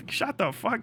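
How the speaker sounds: normal talking voice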